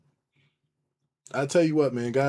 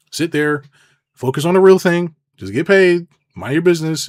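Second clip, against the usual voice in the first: louder, higher, hammering each command; insistent raised commands; emphatic shout; 5 semitones higher, 7 dB louder than usual. Real, not acted. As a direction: drill the advice in hard.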